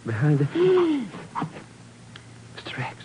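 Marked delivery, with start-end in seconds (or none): 0.5-1.1 gasp. Acted. Finds body behind the desk. 2.5-3.0 whisper. Acted. Whispers after finding body.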